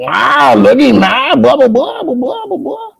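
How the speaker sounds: squeaky mock brag; mocking imitation. Mocking a fellow streamer he won't listen to. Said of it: "He's just gonna be like".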